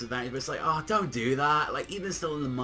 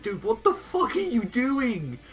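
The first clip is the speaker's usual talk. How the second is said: Beerus losing it; 8 semitones higher, 4 dB louder than usual. Retold or imitated. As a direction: yell at Goku like an exasperated god. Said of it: "Beerus loses it".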